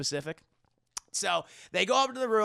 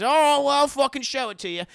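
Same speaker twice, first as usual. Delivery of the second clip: much higher and louder, fed-up; exasperated shout; 9 semitones higher, 10 dB louder than usual. Retold or imitated. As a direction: snap it out impatiently. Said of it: "She says".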